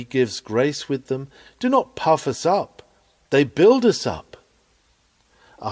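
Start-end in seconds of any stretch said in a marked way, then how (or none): none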